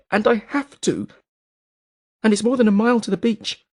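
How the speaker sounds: high, strained woman's voice near tears; a terrified woman admitting her fear; desperate Bellis. A narrator's reading.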